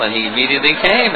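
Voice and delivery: usual speaking voice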